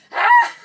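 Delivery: sudden very high, loud scream; piercing mock scream of release; retold scream. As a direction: let out a pent-up shriek, half laughing. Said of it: "really, really ready to scream".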